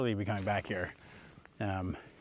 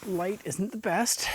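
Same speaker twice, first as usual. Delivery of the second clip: slow, breathless, high; out of breath; 9 semitones higher, much slower than usual. Real, not acted. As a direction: push it out between gasps. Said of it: "I'm out of breath".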